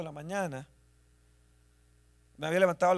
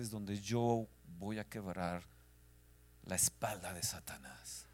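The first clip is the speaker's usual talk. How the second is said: low, slow, fading to a whisper; voice of God; 7 semitones lower, 7 dB quieter, slower than usual. Retold or imitated. Pastor voicing the Lord's answer in prayer, low and hushed. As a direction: speak as the Lord revealing a secret.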